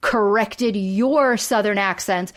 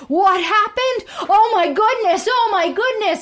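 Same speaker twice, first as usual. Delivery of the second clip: fake phone panic; 11 semitones higher than usual. Michael on fake call to the office, hammed-up alarm. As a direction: squeal it like fake bad news. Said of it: "taking a fake call".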